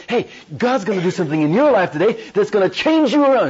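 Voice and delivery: loud, high, eager announcement; excited proclamation. Acted. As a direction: burst in with big news, acted out. Acting an eager bringer of good news.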